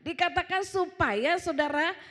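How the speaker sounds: high, loud, deliberate preaching; khotbah lantang. Real, not acted. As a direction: proclaim it to the whole congregation. Berkhotbah lantang. (seruan khotbah lantang dan tegas)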